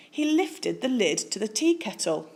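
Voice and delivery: plain reading voice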